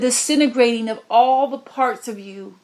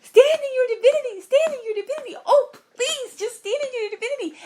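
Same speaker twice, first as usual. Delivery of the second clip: much higher voice, begging through a laugh; desperate pleading; faster than usual. Retold or imitated. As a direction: plead with them, almost laughing in despair. Pleading with souls as world crumbles.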